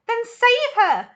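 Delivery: loud, high, slow desperate cry; high loud mother's cry; cried. Acted. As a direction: plead with her husband in panic. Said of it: "cried Missus Salt".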